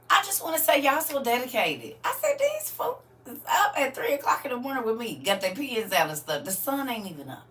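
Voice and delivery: high-pitched, marvelling at their dedication; amazed praise. Real, not acted. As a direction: gush in amazement, pitched well up. Marvelling at dedicated early-morning students.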